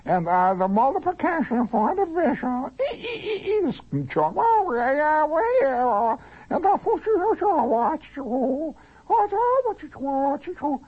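very high-pitched squawking teacher gibberish; screechy math teacher. Acted. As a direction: drone garbled maths way up above the nose. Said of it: "had a teacher that went".

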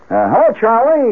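high, overdone hello; hollered greeting. Retold or imitated. Worried man greets his boss downstairs, high hollered hello. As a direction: try for casual and holler it anyway. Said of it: "he hollers".

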